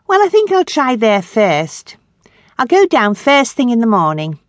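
put-on a young woman, light and eager; lightly voiced girl planning her day; young Grace. Grace telling Chrissy about the store job, eager.

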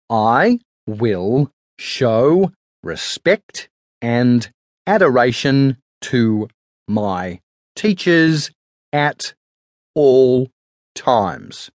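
very slow, word by word with long gaps; dragged dictation pace, each word left hanging; read out as written. A narrator's reading. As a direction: spell it out as the chalk moves. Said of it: "Peter read as Hall wrote".

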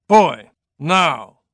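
clipped order that ends the argument; stern tone. Acted. Father ordering his son off to work, stern command. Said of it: "that tone of voice".

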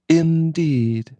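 high, far-off icy single word; chilling indeed. A narrator's reading. Wife chilling the cousin with one cold word. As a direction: freeze him with one word. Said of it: "spoken in a manner to strike terror".

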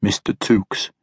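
lightly voiced formal old man; a hint of a courteous elder. A narrator's reading.

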